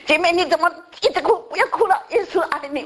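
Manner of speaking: high, fast coaxing to stop a woman crying; retold comforting.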